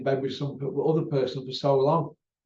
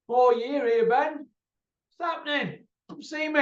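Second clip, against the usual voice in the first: high pleading call; 10 semitones higher than usual. Real, not acted. Pleading call to former neighbour.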